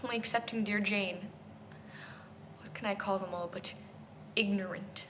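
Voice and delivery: soft, low and halting; subdued regret. Acted. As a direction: drop to a hushed, pained admission. Sad concession after the tirade.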